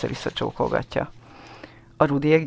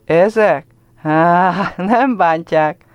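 put-on boy's voice; 4 semitones higher, 5 dB louder, much slower than usual. A narrator's reading. Ruben's voice.

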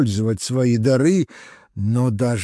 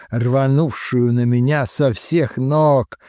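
ordinary delivery

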